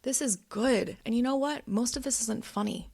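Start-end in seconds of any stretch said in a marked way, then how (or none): 0.0-1.0 higher, slow, sassy "this stuff is good, honey"; delighted praise. Retold or imitated. To herself reading her notes, delighted and sassy. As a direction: admire your own work. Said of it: "And I was like".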